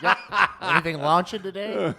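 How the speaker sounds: loud laughing question. Real, not acted. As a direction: talk through a big laugh. Laughing hard.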